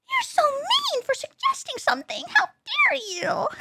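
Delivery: very high, slow mock outrage; offended coworker. Mocking a touchy coworker, high slow mock outrage. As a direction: gasp and clutch pearls in voice.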